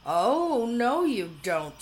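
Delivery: loud, slow warning shout. A narrator's reading. Arabella stops the horse heading to the silo, loud slow shout. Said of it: "shouted Arabella".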